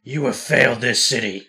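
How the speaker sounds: slow, low, mock-menacing hero line; a lightly voiced Arrow threat, slowed right down; Arrow impression. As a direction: growl it out like a brooding vigilante. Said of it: "Alright, say it".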